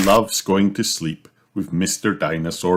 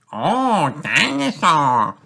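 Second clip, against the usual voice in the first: put-on high, slow toddler voice; a tiny boy in awe; George's voice; 10 semitones higher, slower than usual. Toddler spotting a toy in the window, high slow wonder. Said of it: "says George".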